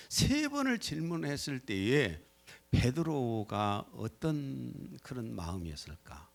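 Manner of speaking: ordinary talk, nothing marked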